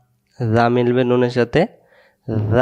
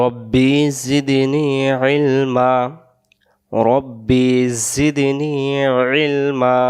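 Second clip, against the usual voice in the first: slow, stretched Arabic recitation; teacher chants the prayer slowly for students to repeat; recited supplication; much slower than usual. Shown as a demonstration. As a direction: chant each word long and even for the class. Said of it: "আবার বলেন".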